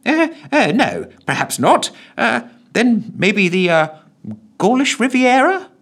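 high, hesitant guessing; flustered secretary. Acted. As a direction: fumble for an answer.

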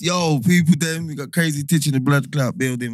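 loud, excited radio shout-out; big it up on air; hype shout-out. Real, not acted. Radio call-in hype greeting.

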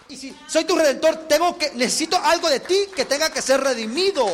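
loud, driving sermon delivery; emphatic preaching. Acted. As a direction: preach it at full force.

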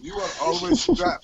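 high, slow mock Iranian accent. Friend echoing the clerk's complaint, high slow Iranian accent. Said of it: "that accent stuck in my head".

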